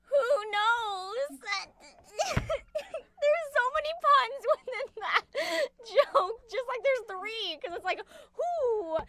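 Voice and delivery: laughing while explaining the pun; cracking up mid-sentence. Real, not acted. Laughing at own pun joke.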